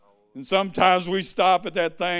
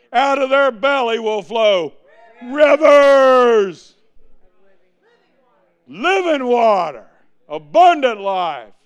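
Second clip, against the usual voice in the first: loud, raised preaching voice; 8 semitones higher, 12 dB louder, slower than usual. Real, not acted. Preacher declaring scripture to the congregation, loud preaching. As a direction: proclaim it, building to a big shout on "rivers".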